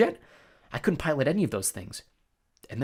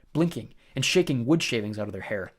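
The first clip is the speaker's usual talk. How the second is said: plain reading voice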